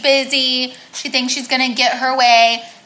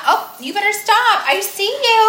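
playful warning; 8 semitones higher than usual. Pretend.

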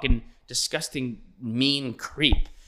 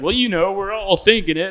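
louder, higher teasing; teasing host; 6 semitones higher, 5 dB louder than usual. Retold or imitated. Host teasing actress guest, louder mock tease. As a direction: needle her guest playfully. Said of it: "She's like".

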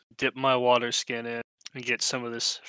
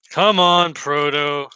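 loud call; 3 semitones higher, 7 dB louder, slower than usual. Pretend. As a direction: call out to pull him up. Pulling friend out of water.

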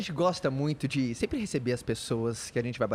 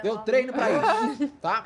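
laughing; 9 semitones higher, 6 dB louder, slower than usual. Real, not acted. Eating egg, laughing.

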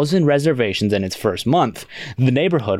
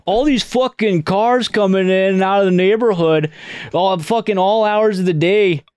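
put-on broad Midwestern accent, higher and brighter; Wisconsin accent; 9 semitones higher than usual. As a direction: play it as a gruff Wisconsin local. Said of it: "I'm also doing a Wisconsin accent".

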